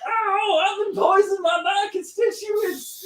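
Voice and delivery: high, slow, melodramatic Texan; hammed-up Texan poisoning victim; Charlie's Texan tycoon. Fake tycoon announcing he's been poisoned, melodramatic Texan accent. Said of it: "dresses like a Texan oil tycoon".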